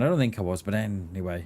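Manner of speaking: ordinary delivery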